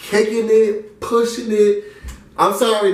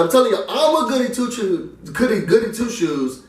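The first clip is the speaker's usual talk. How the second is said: ordinary talk, nothing marked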